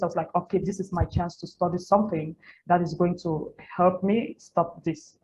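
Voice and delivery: normal talking voice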